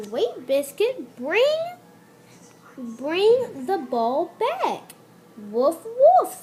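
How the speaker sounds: starts near plain, rising to high loud call; girl calling, building up. Acted. Same line reread, building.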